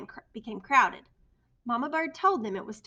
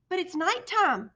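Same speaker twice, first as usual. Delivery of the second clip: high, loud squeak; 8 semitones higher, 7 dB louder than usual. A narrator's reading. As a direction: squeak out a worried protest. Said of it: "Stellaluna squeaked".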